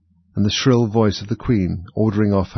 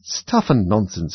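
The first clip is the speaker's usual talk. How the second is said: usual speaking voice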